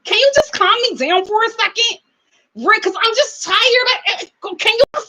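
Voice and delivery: high, needy pleading imitation; mock pleading. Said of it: "talks to Malcolm".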